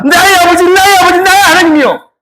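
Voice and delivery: high, loud impassioned calling out; psalmist's cry. Acted. Quoting the psalmist calling to his Father, high impassioned cry. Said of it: "그 시편 기자는 말한다".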